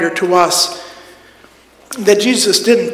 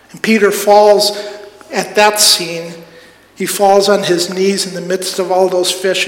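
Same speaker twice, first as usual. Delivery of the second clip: ordinary talk, nothing marked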